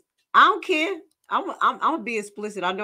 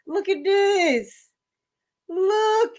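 much higher incredulous look; shocked look; 10 semitones higher, much slower than usual. Real, not acted. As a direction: squeal it in disbelief. Shocked pointing at clip.

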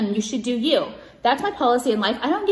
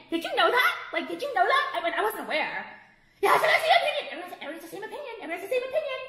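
much higher, sing-song mock surprise; mocking fake voice; 8 semitones higher than usual. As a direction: ridicule them with a squeaky innocent tone, laughing. Mocking critics with fake naive voice.

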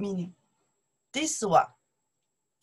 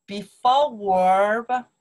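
Before verb slowed and louder; slow emphatic contrast; 7 dB louder, slower than usual. Shown as a demonstration. Teacher contrasting word order, slow and emphatic. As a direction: lean on Before verb to set it apart from after verb. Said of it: "得, 得".

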